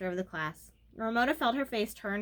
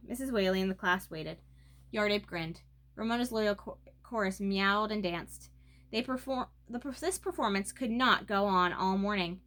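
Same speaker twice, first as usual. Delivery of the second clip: usual speaking voice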